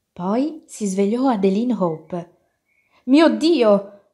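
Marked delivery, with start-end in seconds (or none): 3.0-4.0 loud, high exclamation of alarm; storybook yell of surprise; startled cry. A narrator's reading. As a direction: cry out in shock. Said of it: "urlò e lasciò cadere lo spazzolino".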